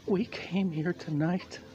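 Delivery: high, slow, quavering plea; mock trembling speech. Comic imitating organizer pleading with the crowd, high and trembling.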